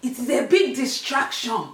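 exaggerated stern mum voice; scolding mum. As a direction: imitate your angry mum laying down the law. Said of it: "And she's like".

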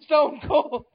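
excited ringside call; mock Jim Ross commentary. Imitating a wrestling announcer on the call. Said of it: "Jim Ross running around giving commentary".

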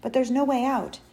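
distressed, hopeless protest; tearful. A narrator's reading. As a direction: plead with a touch of tears. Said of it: "tears running down his face".

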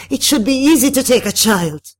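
high, loud, outraged insistence; scream. A narrator's reading. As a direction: scream your objection at it. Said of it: "the madame screamed".

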